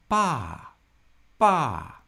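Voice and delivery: "Ba" starting high and loud, falling fast; fourth tone. Shown as a demonstration. Teacher showing learners the fourth tone, high falling "ba". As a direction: drop "ba" from the top of the voice. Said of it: "So the fourth tone sounds like that".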